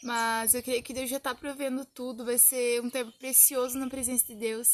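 ordinary delivery

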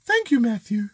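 high mock-lady voice; female voice attempt. Host asking Karen which is closest, high female voice attempt. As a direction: pitch it up like a man faking a woman. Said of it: "I can't do a female voice".

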